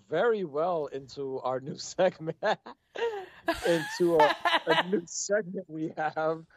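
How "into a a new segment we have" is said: laughing through words. Real, not acted. Laughing while introducing segment.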